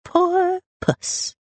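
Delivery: high, drawn mock pity; sing-song taunt; teasing brother. A narrator's reading. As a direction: coo it to needle a sister. Brother repeats his taunt.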